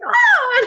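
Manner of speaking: sudden high yelp of fright; imitated high shriek. Retelling her father-in-law getting jump-scared, a high shriek. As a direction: shriek like a startled man. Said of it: "He was like".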